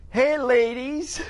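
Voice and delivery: high, drawn-out teasing call; slow, singsong taunting hail; mocking shout to buddies. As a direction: heckle your pals from the shore. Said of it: "If I was standing on the shore and my buddies".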